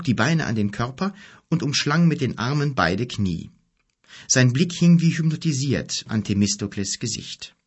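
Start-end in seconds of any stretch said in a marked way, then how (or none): none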